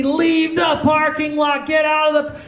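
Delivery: high, drawn-out mock yell; frantic yelling, acted out at a high pitch; imitated angry yelling. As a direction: yell like an irate stranger ordering someone off. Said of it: "She's yelling".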